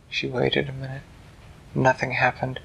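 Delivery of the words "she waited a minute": usual speaking voice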